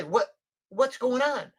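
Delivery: high, slow mock alarm; alarmed client. Old client questions adviser's mysterious note, alarmed. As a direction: imitate a worried client pressing for answers.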